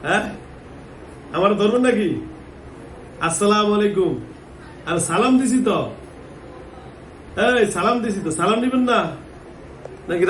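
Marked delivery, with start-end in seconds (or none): 1.4-10.2 raised, higher voice pressing for a reply; insistent calling out. Real, not acted. Trying to get silent woman to respond.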